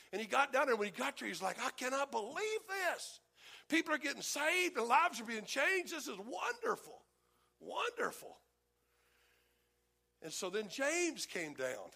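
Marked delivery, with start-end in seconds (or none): 1.6-8.4 taking it all in with wonder; amazed delight. Retold or imitated. Peter seeing the Antioch revival, raised and marveling.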